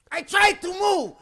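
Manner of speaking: high, worked-up imitation of angry protest; going crazy. Angry guy answers the apology, going crazy. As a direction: protest furiously, way up in pitch. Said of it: "going crazy".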